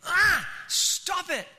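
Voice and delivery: high, loud, drawn-out cry to halt; shouted rebuke. Pretend. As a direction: cut off the objector with a big shout. Preacher halts an imagined objector.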